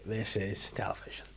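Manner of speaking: hushed, unvoiced whisper; soft reverent whisper; whispered awe. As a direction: whisper it as a momentous verdict. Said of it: "I was like".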